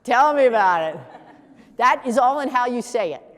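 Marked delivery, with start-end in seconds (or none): 0.0-1.0 unclean tone. Real, not acted. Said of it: "The way you just said it was unclean".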